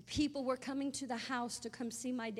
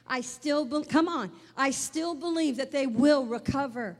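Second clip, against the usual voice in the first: building from firm to a loud, fervent cry; rising faith declaration; 7 dB louder than usual. Retold or imitated. Preacher voicing mother's faith, building to a loud declaration. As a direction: swell into a defiant shout of faith. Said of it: "that's faith talking".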